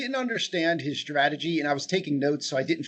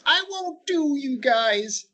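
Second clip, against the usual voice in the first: put-on whining child voice; 9 semitones higher, much slower than usual. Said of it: "an adult trying to sound like a whining child".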